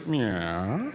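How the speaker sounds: low, dipping then rising yeah; odd drawn-out assent. Retold or imitated. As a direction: stretch it out and swoop up at the end. Neighbor's odd retold reply.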